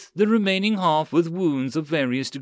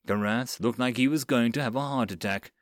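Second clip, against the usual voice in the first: plain reading voice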